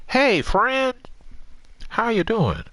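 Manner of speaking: mocking impersonation of an overly friendly manager; nasally manager impression. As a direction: mimic the assistant manager's nasal chirp. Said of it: "talking like that".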